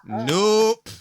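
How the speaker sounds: loud, high, drawn-out nope; emphatic refusal. Real, not acted. Host shutting down the console talk, emphatic refusal. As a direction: shout a big rising "nope".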